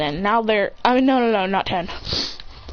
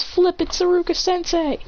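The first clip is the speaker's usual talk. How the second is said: dismayed realization; 8 semitones higher than usual. A narrator's reading. Student realizes teacher is calling.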